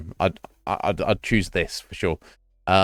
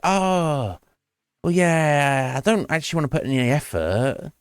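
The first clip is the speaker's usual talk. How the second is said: imitating reluctant slackers; 5 semitones higher than usual. Host mimicking people asked for their plan, mock-reluctant. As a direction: start high and sag into reluctance.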